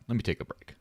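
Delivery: ordinary delivery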